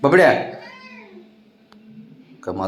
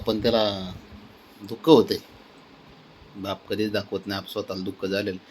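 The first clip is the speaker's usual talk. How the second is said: softer, lower murmur to the camera; quiet aside; 4 semitones lower, 9 dB quieter than usual. Real, not acted. Owner confiding to viewers about worry, soft aside. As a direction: drop your voice as if confiding.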